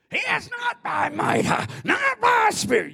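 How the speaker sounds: high, loud mock preacher yell. Mocking preachers who yell the verse, hammed-up shout. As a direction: send up a preacher bellowing the verse. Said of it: "they always yell it".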